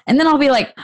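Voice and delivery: ordinary talk, nothing marked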